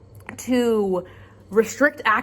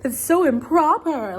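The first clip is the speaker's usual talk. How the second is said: prissy pearl-clutching voice, higher; mock prudish voice; 5 semitones higher than usual.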